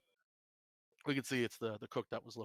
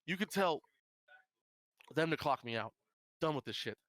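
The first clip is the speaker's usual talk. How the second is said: fed-up quitting; 5 semitones higher than usual. Acted. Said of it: "she, she says".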